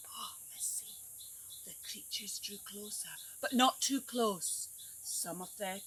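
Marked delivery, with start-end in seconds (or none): 0.1-1.0 hushed whisper. Real, not acted. Teacher whispers to the children to see what happens. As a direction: lean in and whisper.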